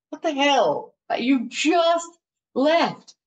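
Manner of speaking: put-on high-pitched, incredulous boyfriend; startled disbelief. Boyfriend to woman home early from wedding, startled disbelief. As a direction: imitate baffled surprise, voice lifted. Boyfriend reacting to her return.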